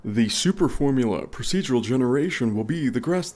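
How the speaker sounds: a bad imitation of a game developer hyping his tech; terrible Sean Murray impression. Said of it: "my terrible impression of Sean Murray".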